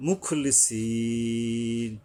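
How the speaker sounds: মুখলিসিন with a very long level ee; drawn-out recitation. Shown as a demonstration. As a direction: slowly hold the last vowel of মুখলিসিন. Teacher demonstrates recitation. (মুখলিসিন শেষে লম্বা টান)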